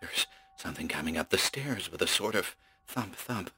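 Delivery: whispered terror; terrified. Acted. Terrified man reports the creature.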